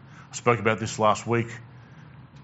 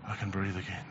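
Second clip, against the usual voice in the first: soft relieved sigh; 3 semitones lower, 12 dB quieter than usual. Pretend. Relieved breath after gasp.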